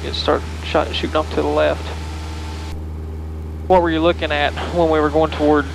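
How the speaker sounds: ordinary talk, nothing marked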